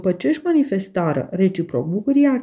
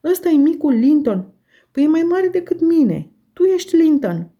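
put-on much higher, louder, thrilled young girl; bright excited girl's voice, pitched up high; 10 semitones higher, 4 dB louder than usual. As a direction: gasp it out in delighted disbelief. Catherine recognises her cousin.

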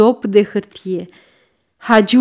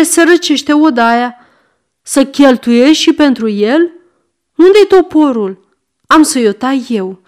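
louder, higher frenzied yelling; furious snap; 5 semitones higher, 4 dB louder than usual. Acted. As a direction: rage at the cat, shouting. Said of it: "se resti". (strigăt furios, răstit)

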